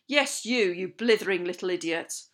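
loud, scornful outburst; angry shouting. A narrator's reading. Captain berating a pupil, shouting angrily. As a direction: berate him at parade-ground volume. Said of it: "He was shouting at me".

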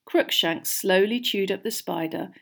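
ordinary delivery, nothing marked

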